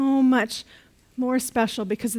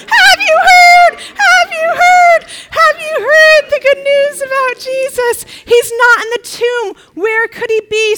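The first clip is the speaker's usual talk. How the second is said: much higher, much louder squealing; very high-pitched; 13 semitones higher, 11 dB louder than usual. Acted. Mary running to Peter and John, high-pitched and loud. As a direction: shriek it up high. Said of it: "in a very high-pitched voice".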